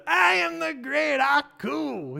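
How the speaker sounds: high, booming mock villain god; Aku voice. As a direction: ham up the cartoon demon lord again. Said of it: "I'm not gonna do the voice anymore".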